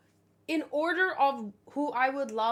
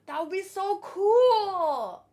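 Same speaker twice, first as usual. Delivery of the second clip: higher, louder, drawn-out delighted cry; thrilled outburst; 6 semitones higher, 8 dB louder, slower than usual. Real, not acted. Streamer loves the Shanks theory, slow excited cry.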